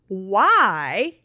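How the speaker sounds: "why" drawn out with a big swoop in pitch; exaggerated, emphatic "why"; all-capitals WHY. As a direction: stretch and punch the word "why". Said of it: "and this is in all capitals".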